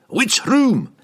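high, loud dwarf bark; impatient demand. A narrator's reading. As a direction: fire the question at him. Dwarf demands answer from wizard.

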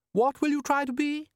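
high, stern wife voice; bossy wife. A narrator's reading. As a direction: quiz him like a nagging wife. Wife voiced, scolding husband.

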